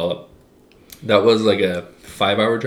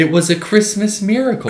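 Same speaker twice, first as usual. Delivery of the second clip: much higher, excited echo; 11 semitones higher than usual. Real, not acted. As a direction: squeal it with delight.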